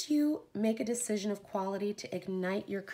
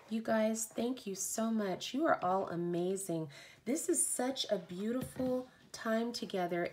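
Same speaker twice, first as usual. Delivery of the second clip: ordinary delivery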